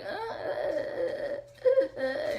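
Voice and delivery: high, loud mock baby wail in short bursts; imitated newborn cry. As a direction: mimic a newborn's first cries. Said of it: "he finally just was like".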